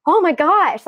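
high, loud mock gasp of realization; retold amazement. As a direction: re-enact being blown away. Quoting her own amazed realization.

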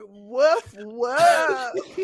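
a bassier mock woof, laughing; lightly voiced big-dog woof. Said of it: "That dog was like".

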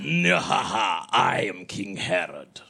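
booming theatrical villain; hammed-up King Herod voice; hammy stage actor. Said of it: "speaking as Gav, the actor".

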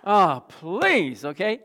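loud, drawn-out mock groan of disbelief; exasperated. Patient reacting to the gushing hygienist, exasperated. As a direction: stretch out "please", fed up.

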